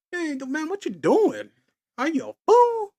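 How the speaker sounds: high, loud mock scolding of himself; high, loud "are you a fool". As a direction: yell at your younger self, half joking. Scolding his younger self.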